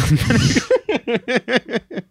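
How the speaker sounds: high bursting laugh at own joke; a real laugh breaking out; burst of laughter. Real, not acted. Laughing at her own long funeral-sub tangent.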